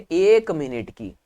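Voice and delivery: एक punched, then minute की drawn out slowly; slow emphatic answer. Shown as a demonstration. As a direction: lean on एक and take the whole phrase slowly, like a teacher. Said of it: "अब ध्यान देना". (एक पर ज़ोर, धीरे-धीरे)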